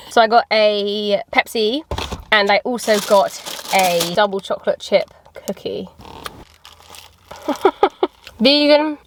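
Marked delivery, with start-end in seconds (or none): none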